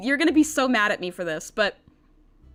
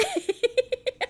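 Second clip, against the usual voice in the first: high laugh; 13 semitones higher than usual. Real, not acted. Laughs after knocking mic.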